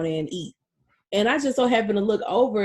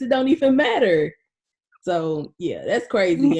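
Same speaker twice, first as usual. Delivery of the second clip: ordinary talk, nothing marked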